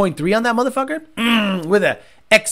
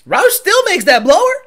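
mocking; 10 semitones higher, 6 dB louder than usual. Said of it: "people are gonna go".

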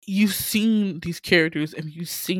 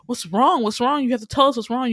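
urgent pressing; 5 semitones higher, 5 dB louder than usual. Retold or imitated. Friends pressing crying girl, acted.

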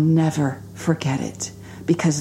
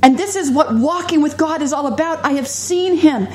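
high, fervent preaching climb; 6 semitones higher than usual. Acted. Preacher testifying to the congregation, rising fervent preaching. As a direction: testify with growing fervour.

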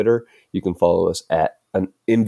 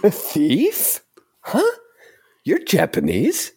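much higher, puzzled then protesting; indignant hero; 11 semitones higher than usual. Acted. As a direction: play the confused boy denying it. Acted anime scene, character A.

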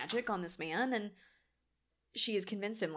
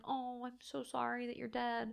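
soft, higher mock-sad lament; imitated mourning; 4 semitones higher, 9 dB quieter than usual. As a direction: coo a small, sorrowful apology. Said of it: "still mourns him and is still sad".

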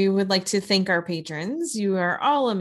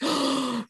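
shocked gasp; 7 semitones higher than usual. Retold or imitated. Roommate never saw Moonstruck.

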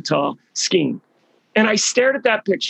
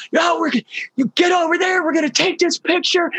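excited yelling; 12 semitones higher than usual. Retold or imitated. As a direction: yell it across the chairlift, high and thrilled. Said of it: "they're scr".

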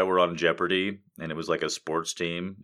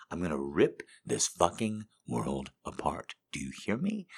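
mock villain whisper; 3 semitones lower, 6 dB quieter than usual. Streamer joking as a villain, whispered threat. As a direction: hiss it like a supervillain. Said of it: "mock villain rant".

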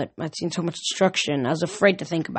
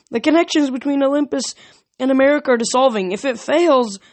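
higher, urgent and fast; urgent warning; 7 semitones higher, 4 dB louder than usual. Acted. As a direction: rush the warning out, keyed up. Tense character warning.

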